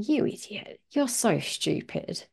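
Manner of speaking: softly voiced mock contempt; belittling a child. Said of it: "you looking down at them going".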